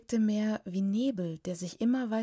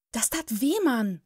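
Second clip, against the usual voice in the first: whining; 4 semitones higher, 5 dB louder than usual. A narrator's reading. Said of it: "jammerte sie".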